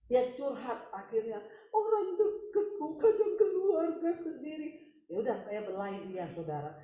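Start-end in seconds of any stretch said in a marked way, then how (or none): 1.7-5.0 high, drawn-out mock lament; imitated confiding woman. Woman finally confiding in the pastor, imitated plaintively. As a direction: pour it out slowly, pitched up.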